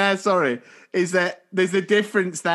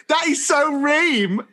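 higher, drawn-out Essex slang; mock Essex accent; 6 semitones higher, slower than usual. Teasing co-host's failed accent, slow mock Essex. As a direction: stretch it out in hammed-up Essex. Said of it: "Are you from Essex?".

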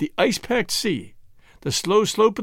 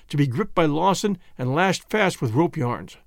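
ordinary delivery

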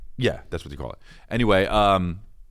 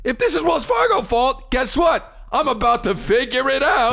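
very high, strained threat; comic exasperated rant; 14 semitones higher than usual. Retold or imitated. Comic vows to find out whose fault, exasperated rant. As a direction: build the rant way up in pitch. Escalating rant over declined card.

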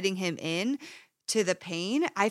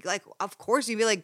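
normal talking voice

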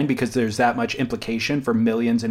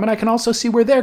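higher voice, other side of the argument; pitched higher for the other side; 11 semitones higher, faster than usual. Retold or imitated. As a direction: jump higher to voice the other view. Weighing two sides of a fight.

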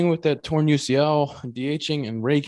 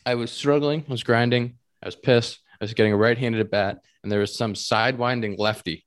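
usual speaking voice

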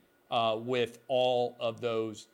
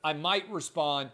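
usual speaking voice

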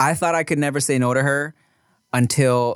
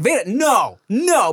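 higher-pitched mock alarm at a toddler; mildly exclaimed parental warning; reenacted urgent scolding; 7 semitones higher, slower than usual. Reenacts stopping toddler chewing marker.

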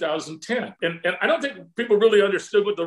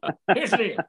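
Ross Perot impression; 4 semitones higher than usual. Guest still mimicking Ross Perot to Todd, laughing. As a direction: repeat the deal line, cracking up. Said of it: "here's Ross Perot".

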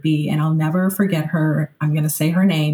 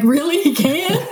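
retold disbelief, much higher; higher surprised double-take; incredulous surprise; 10 semitones higher than usual. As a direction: ask it half laughing, amazed. Astonished at therapist's claim.

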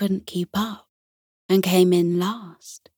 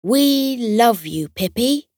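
cheerful banner message; 5 semitones higher than usual. A narrator's reading. Friends' banner tells Pippi they love her, fond and bright. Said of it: "The banner read".

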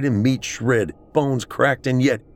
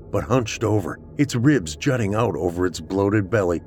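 ordinary delivery, nothing marked

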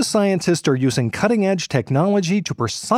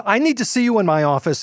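normal talking voice

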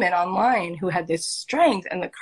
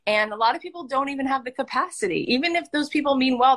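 usual speaking voice

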